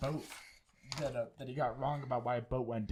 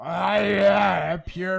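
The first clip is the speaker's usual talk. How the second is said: loud, higher, hammed-up French accent; mock French accent; 6 semitones higher, 14 dB louder, much slower than usual. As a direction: shout it like an overexcited Frenchman. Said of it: "That was my French accent".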